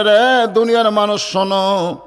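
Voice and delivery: high chanted reproach to a crowd; mildly angry, in preacher's singsong; retold angry rebuke. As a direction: intone a stern call, stretched out. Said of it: "ইমাম সাহেব রাগ করে বলে".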